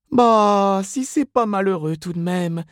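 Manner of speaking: high put-on woman's voice; commiserating saleswoman. A narrator's reading. Miss Inez pities Mrs Reilly over counter, commiserating. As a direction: sympathise in a high gossip's voice.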